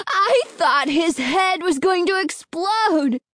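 high, drawn-out glee; gleeful exclamation. A narrator's reading. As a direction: relish it in a high, excited voice. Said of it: "Alan exclaimed gleefully".

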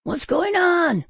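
high, drawn-out startled cry; startled scream. A narrator's reading. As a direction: wake with a jolt and cry out high. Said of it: "I screamed as my eyes snapped open".

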